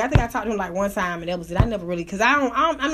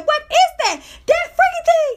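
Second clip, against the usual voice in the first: much higher, louder disbelief; high incredulous shriek through a laugh; shocked squeal; 13 semitones higher, 4 dB louder than usual. Retold or imitated. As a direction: squeal at the sight. Same retold reaction.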